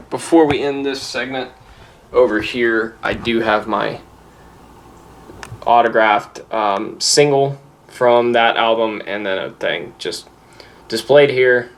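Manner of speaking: ordinary delivery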